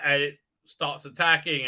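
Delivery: ordinary delivery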